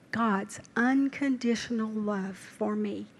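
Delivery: slow breathy whisper of awe; hushed awe. Real, not acted. Preacher marveling at God's love, in a slow whisper. Tender sermon reflection.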